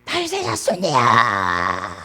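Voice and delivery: strained, drawn-out cry for help. Acted. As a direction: act out blind men calling desperately after someone. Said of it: "소리를 지릅니다". (길게 짜내는 간절한 외침)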